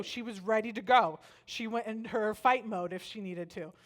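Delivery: ordinary talk, nothing marked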